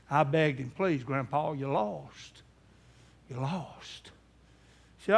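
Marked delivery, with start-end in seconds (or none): 0.8-4.1 soft, low, pleading; broken plea. Retold or imitated. Grandson begs his lost grandpa, soft and breaking. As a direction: beg him quietly, near tears.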